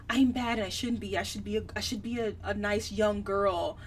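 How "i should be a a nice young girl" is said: ordinary talk, nothing marked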